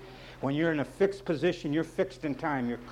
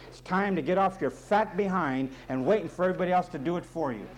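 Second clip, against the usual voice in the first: ordinary delivery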